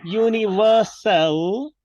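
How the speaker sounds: UNIVERSAL slow, with rising end; drawn-out choral model. Shown as a demonstration. Teacher leads class repetition, slow universal. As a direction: stretch UNIVERSAL out for repeating. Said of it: "please say with me".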